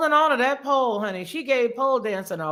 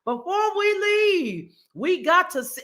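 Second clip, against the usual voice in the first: ordinary talk, nothing marked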